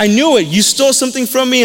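storyteller's heated accusation; accusing. Retold or imitated. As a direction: act out catching a thief red-handed. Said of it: "Joseph again accuses them".

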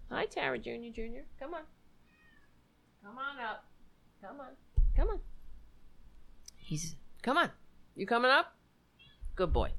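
soft, gentle coaxing; coaxing a dog. Real, not acted. Host calling Tara Junior to come on up, softly coaxing. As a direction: quietly call a pet up to you.

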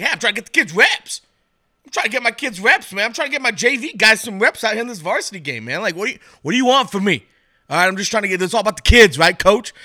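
put-on a coach swearing he's only getting the kids reps; defensive high school coach.

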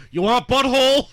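laughing through the line. Retold or imitated. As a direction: repeat it while cracking up. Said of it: "I need to take that soundbite".

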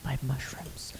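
whispered echo of the tagline; whispering. Acted. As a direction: breathe the words, no voice. Echoing the sign-off.

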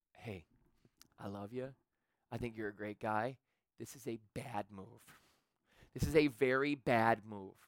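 low, soft, sincere counsel; caring warning. Acted. Friend warning against a costly car, tender quiet honesty. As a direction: break it to a friend gently and quietly.

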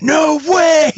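high startled shout; shouted disbelief. Retold or imitated. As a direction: yell it out in shock. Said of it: "he went".

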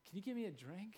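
softly spoken, low-key question; quiet request. Retold or imitated. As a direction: ask softly, without any push. Acted quote of Jesus.

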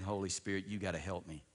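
low, soft plea under the breath; hushed retold prayer for help; inward prayer. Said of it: "on the inside, I'm praying".

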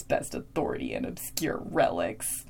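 low, hushed Giles impression; mock Giles. Recapper voicing Giles low and hushed to listeners. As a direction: breathe it out low like a fussy librarian.

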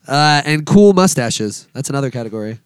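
ordinary delivery

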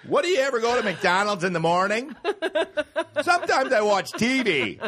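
hammed-up clueless comic shouting; shouting out things. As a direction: holler it at an imaginary crowd. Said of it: "Just shouting out things".

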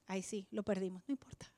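mock hushed quote. Mocking what people say.

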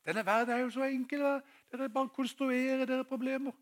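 high, breezy mocking voice; mock dismissive. Mock version of what Jesus says. (lys, spøkefullt avfeiende stemme)

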